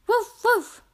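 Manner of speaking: high playful puppy woofs; puppy barking. Pretend. Narrator voicing Biscuit the puppy for kids, high woofs. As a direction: bark like a happy little puppy.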